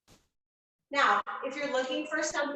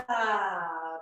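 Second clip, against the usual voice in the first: dramatic sigh; 6 semitones lower than usual. Shown as a demonstration. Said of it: "just some sort of audible sound".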